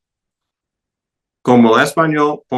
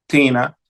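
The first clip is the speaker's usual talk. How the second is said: "tina" as TI-na; stress on first syllable; 4 semitones higher than usual. Shown as a demonstration. Teacher reciting interrogative forms, first-syllable stress. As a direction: hit the TI of "tina", let na fall away. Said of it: "la primera sílaba".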